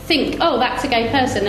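usual speaking voice

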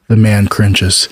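usual speaking voice

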